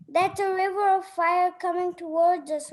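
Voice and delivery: ordinary delivery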